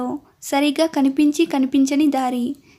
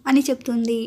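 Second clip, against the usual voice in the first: ordinary delivery, nothing marked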